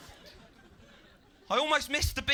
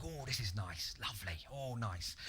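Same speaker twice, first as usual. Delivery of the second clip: contented murmur; 7 semitones lower, 10 dB quieter than usual. Retold or imitated. Cosy in sleeping bag.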